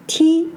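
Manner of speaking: "Ti" held high and level; first tone. Shown as a demonstration. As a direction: keep "Ti" on one steady high pitch. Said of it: "but have different tones".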